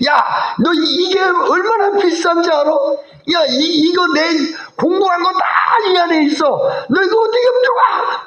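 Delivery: put-on high, strained outburst at the child; angry father. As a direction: act out a dad exploding over lost work. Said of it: "아빠가 막 화를 내니까". (버럭 화내는 아빠 목소리)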